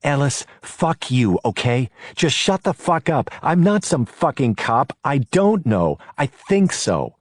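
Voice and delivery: high, loud furious outburst; angry. A narrator's reading. Nancy turning on Ellis angrily in the car, loud and high. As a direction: snap at him, fed up and scared. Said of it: "turned on him angrily".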